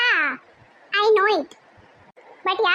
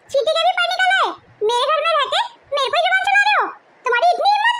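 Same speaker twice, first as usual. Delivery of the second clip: high-pitched indignant outburst; outraged; 6 semitones higher than usual. Acted. Sister-in-law outraged that her brother-in-law talks back.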